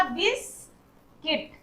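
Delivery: slow "बिस्किट" broken as bis, kit; silent u. Shown as a demonstration. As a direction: split "बिस्किट" softly into two syllables, no u. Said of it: "यू का sound नहीं हो रहा". ("बिस्किट" धीरे तोड़कर, यू के बिना)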